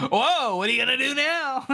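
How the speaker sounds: high, loud goading whoop; put-on manic jeer; mock unhinged taunt. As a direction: tease wildly, hammed up. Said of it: "and I say unhinged".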